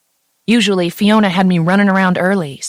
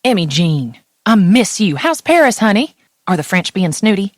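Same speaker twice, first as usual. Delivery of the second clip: put-on Tennessee mom accent; 3 semitones higher, 4 dB louder than usual. Mother calling her daughter abroad, in a light Tennessee accent. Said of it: "My mom's accent burst through the phone".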